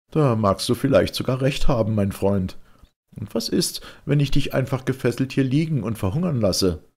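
höher und lauter, überlegen spottend; spöttischer Ich-Erzähler. Acted. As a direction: den Gefangenen mit ironischer Höflichkeit necken. (Erzähler verspottet den gefesselten Mönch lauter und höher)

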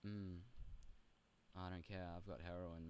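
usual speaking voice

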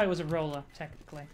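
normal talking voice

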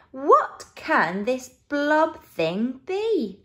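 usual speaking voice

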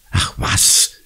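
low-key, unvoiced growl; growled dismissal. A narrator's reading. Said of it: "knurrt Conny". (abwinkend geknurrt)

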